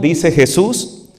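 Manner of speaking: normal talking voice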